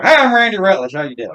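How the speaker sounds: high country greeting, dropping off at the end; Randy Rutledge. Retold or imitated. Cousin introduces himself to a stranger, country character voice. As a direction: start high on the hello, then let it fall. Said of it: "Michelle's like".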